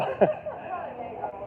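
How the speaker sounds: high breathless disbelieving laugh; stunned laugh. Real, not acted. Hearing he lost the job, a stunned airy laugh. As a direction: let out a shocked airy laugh. Reacting to job rejection news.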